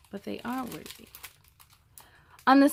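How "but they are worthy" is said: a soft, breathy whisper to the listener; whispered aside. Real, not acted. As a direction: lean in and whisper it like a secret. Reader's own aside on the story.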